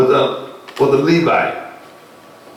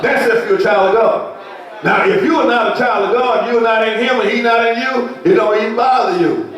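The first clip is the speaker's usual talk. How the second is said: higher, ringing preacher's call; preaching at full force; 7 semitones higher than usual. Acted. Preacher asking the congregation about conscience, ringing call. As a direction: build it up to the church.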